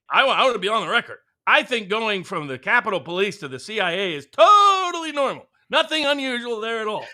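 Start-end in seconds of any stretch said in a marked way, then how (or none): 1.4-7.0 exaggerated sarcastic insistence. Real, not acted. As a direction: lay the sarcasm on thick, stretching the key word. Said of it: "Hashtag sarcasm".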